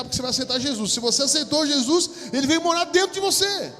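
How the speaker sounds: loud, high preaching cry; full-force preaching. Acted. Preacher proclaiming to the congregation, loud and high. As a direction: preach it out with fire.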